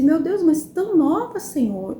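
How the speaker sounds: súplica mais aguda que cai no "Senhor"; súplica recontada. Retold or imitated. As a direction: lamentar a Deus em tom mais alto. Roga a Deus pelas moças. (súplica a Deus, recontada)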